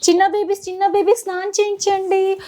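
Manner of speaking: put-on high, playful singsong for a toddler; sweet nursery singsong; baby-talk singsong. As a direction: coax the baby with a lilting game voice.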